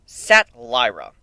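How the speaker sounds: ordinary delivery, nothing marked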